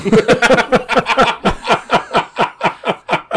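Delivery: loud high laughter; long laugh. Real, not acted. Laughing at the shoot him punchline. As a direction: crack up.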